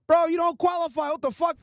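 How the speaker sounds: ordinary delivery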